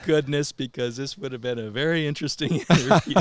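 loud, fast relieved laughing; relieved laughter. Real, not acted. Relief after spider scare.

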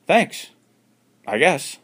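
high, loud unsure thanks; dazed Alan voice. Acted. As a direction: give grudging thanks.

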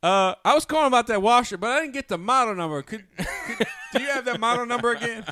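high, loud goofy caller voice asking for the number; second prank caller. Retold or imitated. Prank caller asking about the washer, high goofy voice. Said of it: "another guy on the show will be like".

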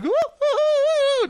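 very high, slow cartoon whoop; goofy cartoon duck whoops; Daffy Duck. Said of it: "literally Daffy Duck".

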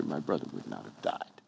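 low, soft grieving lament. Retold or imitated. Mary to Jesus, a low soft grieving reproach. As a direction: let it fall away in grief. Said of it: "says the exact same thing".

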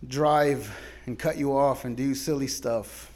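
normal talking voice

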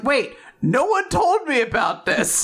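high-pitched startled protest; mock indignation. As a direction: protest as if caught unawares, pitch up. Latla protesting, acted.

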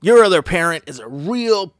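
put-on co-parent trashing the other parent to the children; bitter insult. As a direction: spit it out to the kids. Co-parent badmouthing in front of kids.

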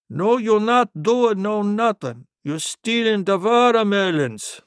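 high-pitched grandpa, drawn-out accusation; accented scolding grandpa. Acted. Grandpa accusing his son of stealing watermelons, accented scolding. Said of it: "He said to me".

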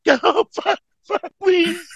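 high strained begging; pained pleading. Retold or imitated. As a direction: plead through the pain. Begging friend to stop.

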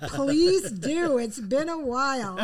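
loud, high mock-eager reply; cheeky eager plea. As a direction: blurt it out as a cheeky punchline. Cheeky punchline to stranger's request.

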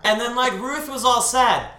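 hammed-up story announcement; mock passion play. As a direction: declaim it like an overdone play. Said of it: "her adaptation of, like, a passion play".

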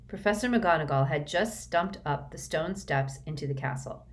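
ordinary delivery, nothing marked

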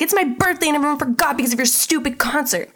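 imitated aggrieved complaint; mock outburst. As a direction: rant it like a wronged kid. Acts out Timmy's angry complaint.

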